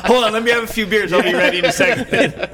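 high, loud hammed-up buddy voice eager for beers; mock drunk eager self. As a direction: play up your tipsy younger self, loud and high. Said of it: "I'm like".

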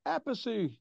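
high, careful imitation of a frontman. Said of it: "He wanted to say it correctly".

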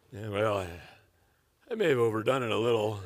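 low, hesitant understatement; sheepish admission. Acted. Husband answering wife about the punishment, sheepish and low. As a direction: own up reluctantly to overdoing it.

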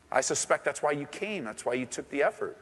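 ordinary delivery

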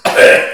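loud hacking cough. Retold or imitated. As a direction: cough it out rough. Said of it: "hit you with that cough".

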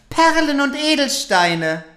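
high, loud, demanding stepsister voice; greedy stepsister. Acted. Second stepsister wishing.

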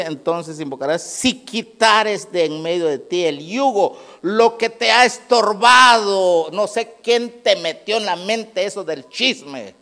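high, loud, slow preacher's cry; preaching at full force. Acted. Pastor preaching scripture to the congregation, at full force. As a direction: proclaim it to the whole church, drawn out.

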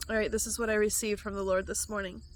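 ordinary talk, nothing marked

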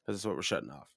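normal talking voice

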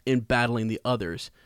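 ordinary talk, nothing marked

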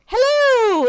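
very high, loud singsong hello; exuberant phone greeting. Retold or imitated. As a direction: imitate a friend cheerily picking up the phone. Friend answering the phone, retold.

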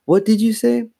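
high, incredulous question; bewildered question. A narrator's reading. Confused girl to her confessing twin, bewildered. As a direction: ask high, unable to take it in. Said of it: "Chris didn't understand".

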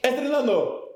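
high, repeated fading jingle; mock studio reverb; fake echo. Shown as a demonstration. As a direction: say it as a hammed-up echo. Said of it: "A ver, otra vez".

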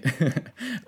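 bright self-mocking laugh; short laugh. Real, not acted. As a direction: laugh at yourself. Laughs at himself.

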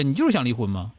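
ordinary delivery

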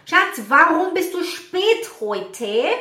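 alto e arrastado, subindo no fim; esposa questionando. Acted. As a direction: cobre o marido atrasado, voz aguda e lenta. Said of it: "e você fala". (cobrança ao marido atrasado)

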